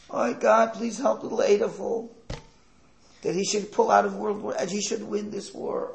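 high, loud mock pleading; hammed-up wail of a praying mother; mock tearful prayer. Hitler's mother begs God for her son, mock tearful plea. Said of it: "she's crying".

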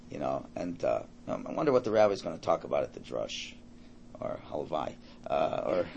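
low, soft, idle musing; quiet daydream during prayer; distracted inner thoughts. Voicing a distracted worshipper's thoughts.

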